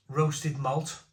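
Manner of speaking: ordinary talk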